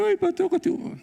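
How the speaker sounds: hammed-up complaining believer; whining churchgoer. Preacher imitating members who come complaining to the pastor, mock whine. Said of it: "Hay hermanos que me vienen".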